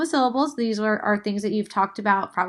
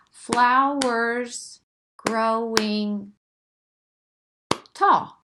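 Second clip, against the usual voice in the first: trying a haiku line; much slower than usual. Shown as a demonstration. Teacher tries her own haiku line aloud. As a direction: draw flowers growing out, then pop tall. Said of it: "let me try it".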